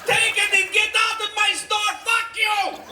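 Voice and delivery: high furious yelling; angry shouting. Acted. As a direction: yell him out of your store. Angry store owner yelling.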